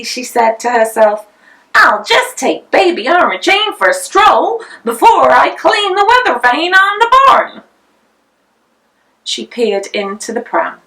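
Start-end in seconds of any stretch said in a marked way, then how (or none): 1.7-7.6 cheerful Missus Potter. A narrator's reading. Mother voiced by narrator.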